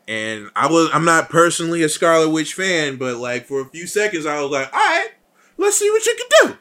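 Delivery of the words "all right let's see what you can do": very high, loud, excited squeal; hyped-up egging on. Retold or imitated. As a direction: egg the hero on in a high mock cheer. Acts out cheering on Scarlet Witch.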